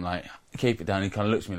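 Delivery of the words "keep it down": ordinary delivery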